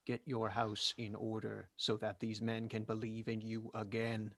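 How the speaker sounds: ordinary talk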